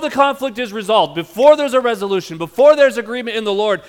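loud, high, driving pulpit voice; loud sermon climax; preaching at full force. Acted. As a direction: preach it out to the back row.

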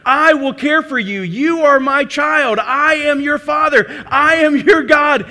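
slow, loud, fervent preaching; forceful preached declaration, words drawn out near the end; voicing God's promise. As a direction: declare it slowly with full weight, as God speaking to his child. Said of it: "What he's saying is".

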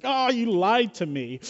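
high, drawn-out hammed-up wail of betrayal; mock devastated. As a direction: overplay being crushed, stretching the words out. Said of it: "I was kind of devastated".

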